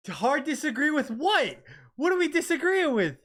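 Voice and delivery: high, loud indignant question; baffled, push back at chat; incredulous. Real, not acted. Reacting to chat disagreement.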